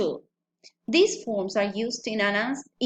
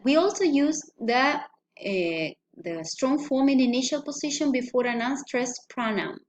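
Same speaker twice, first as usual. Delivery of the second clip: normal talking voice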